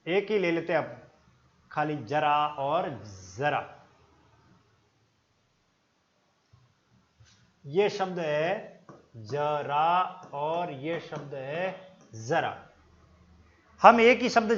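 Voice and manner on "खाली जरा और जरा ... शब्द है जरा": जरा then जरा, slow and soft; careful contrast. Shown as a demonstration. Teacher contrasting two words for students, slow and soft. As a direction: set the two जरा words apart slowly. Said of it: "इसका उच्चारण यहां से होता है गले वाले भाग में".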